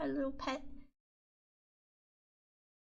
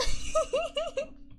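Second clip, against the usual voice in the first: high giggle fit; 14 semitones higher, 5 dB louder than usual. Real, not acted. Laughs at own accomplishment.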